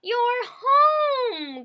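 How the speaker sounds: high, loud gushing cry; joyful shout. A narrator's reading. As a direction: burst out like a delighted old busybody. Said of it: "Goldie shouted".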